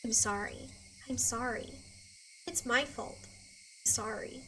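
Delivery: soft, low, emptied apology; a sedated girl repeating sorry to herself; numb murmur. Acted. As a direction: murmur it mechanically, far away. Drugged, numb repetition.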